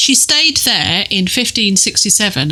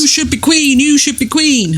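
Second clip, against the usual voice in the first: higher-pitched, playful urging voice; playfully egging her on in a raised pitch; mock crowd of supporters; 7 semitones higher than usual. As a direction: chant it like an eager follower. Voices supporters massing round Mary.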